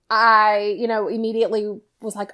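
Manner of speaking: ordinary talk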